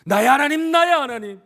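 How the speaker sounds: very high, loud cry. Acted. Jesus on the cross calling to God, crying out. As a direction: call out to God at full voice. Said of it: "주님의 절규가 아닙니다".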